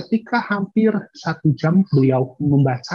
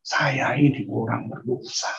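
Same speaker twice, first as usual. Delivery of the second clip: hushed confession; 4 semitones lower, 4 dB quieter than usual. Retold or imitated. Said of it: "Orang-orang menyadari".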